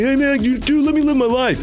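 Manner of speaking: high-pitched mock protest; exaggerated high complaint; protesting brother. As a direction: whine back at a pestering brother. Acted brother refusing to pay.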